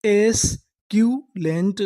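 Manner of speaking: एस्क्यूलेंट drawn out slowly, each syllable separate; slow teaching read. Shown as a demonstration. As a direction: sound it out slowly. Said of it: "पूरी meaning क्या बनी?".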